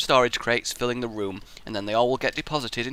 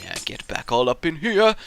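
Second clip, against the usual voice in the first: usual speaking voice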